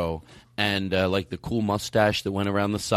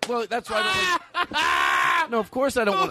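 protesting shout. Real, not acted. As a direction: yell it up high defending yourself. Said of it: "protesting loudly".

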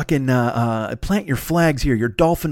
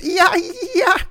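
short high dolphin squeals; imperfect dolphin noise. Retold or imitated. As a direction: yelp like a badly imitated dolphin. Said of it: "can't deliver a fucking perfect dolphin noise".

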